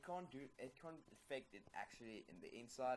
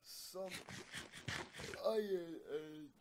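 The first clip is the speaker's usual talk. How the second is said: slow yawning words; 8 semitones higher, 7 dB louder, slower than usual. Real, not acted. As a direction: say it mid-yawn. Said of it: "I don't know why I'm yawning like this right now".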